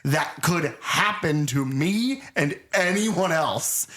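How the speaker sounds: very slow, drawn-out mock dismay; rattled, unnatural speech. Imitating the shaken doctor, slow unnatural delivery. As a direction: stretch each word out, theatrically. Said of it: "he's not talking like people talk".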